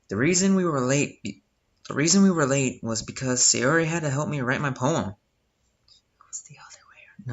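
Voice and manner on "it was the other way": whisper. Acted. Whispered aside while playing.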